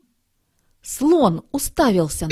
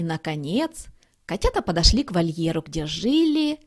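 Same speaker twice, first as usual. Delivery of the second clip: usual speaking voice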